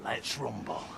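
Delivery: very low, hushed fight challenge. Acted. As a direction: answer the threat low and quiet. Answers tough talk before bout.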